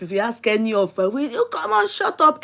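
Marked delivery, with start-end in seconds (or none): 1.1-2.4 imitated scolding. Acts out daddy cutting her off.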